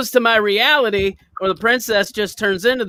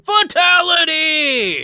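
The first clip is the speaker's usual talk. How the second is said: high, drawn-out video game battle cry; mock scream; 10 semitones higher, much slower than usual. Masked man screams at people who get too close, mock scream. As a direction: scream it slowly like a game finishing move. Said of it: "and scream".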